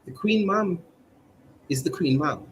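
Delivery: usual speaking voice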